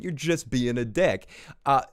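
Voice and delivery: ordinary talk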